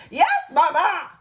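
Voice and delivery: put-on loud, high child's answer; very loudly. Loud Mouse answering mama very loudly. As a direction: shout the reply. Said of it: "very loudly".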